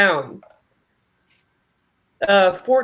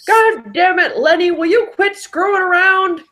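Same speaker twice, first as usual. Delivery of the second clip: higher-pitched scolding kobold voice; 6 semitones higher than usual. Acted. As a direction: scold Lenny as an exasperated kobold. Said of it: "do a kobold voice again".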